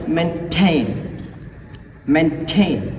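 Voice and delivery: "maintain" stressed on "tain", with a puffed T; stressed aspirated T. Shown as a demonstration. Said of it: "can be stressed with a second syllable".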